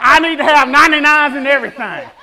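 loud, laughing outburst; half-laughing shout; emphatic shout. Real, not acted. Preacher shouting his point.

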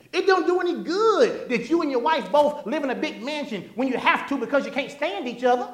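loud, high pulpit delivery; preaching. Acted. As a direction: preach it out to the whole church. Preaching at full force.

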